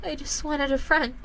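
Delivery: high, tight voice on the edge of tears; a boy confessing to his father, voice close to breaking; holding back tears. A narrator's reading. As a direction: fight the tears as the words come out. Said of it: "trying to fight back the tears".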